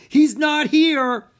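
very high, loud wail, stretched out; panicked cry. A narrator's reading. Theater manager in panic over the missing star, crying out. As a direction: wail the bad news. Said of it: "he cried".

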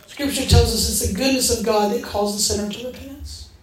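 ordinary talk, nothing marked

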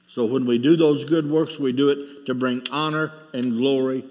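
ordinary delivery

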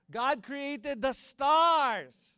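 high, drawn-out preacher's cry; loud proclamation. Real, not acted. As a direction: stretch it out loud and triumphant to the congregation.

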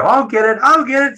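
put-on high, loud eager children calling out; eager kids. Kids volunteering to fetch the priest water, high eager calls. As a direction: squeal it like excited little kids racing to help.

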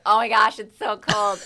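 loud retold exclamation at the cold; retelling cold shock. Singer reliving walking into the ocean, loud dismay. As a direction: blurt it out as if hit by cold water.

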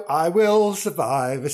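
slow, drawn-out defiant line; milk it like a diva; slow mock-heroic declaration; dramatic quip.

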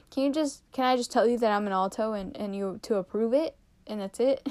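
normal talking voice

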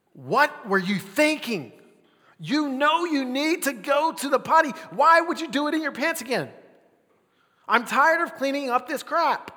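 put-on loud, high scolding of a toddler; frustrated dad yelling. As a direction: snap at the potty-training kid. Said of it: "My voice gets a whole lot deeper. My tone is a whole lot higher".

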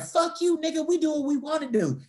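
high-pitched mimicked drunk girl; imitated high, defiant sass; defiant retort. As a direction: throw it back in his face, high. Drunk girls' defiant retort, acted.

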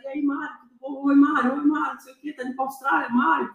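quick run of mimicked hellos from strangers; a hint of chirpy well-wishers; imitated greetings. Strangers' greetings repeated in retelling.

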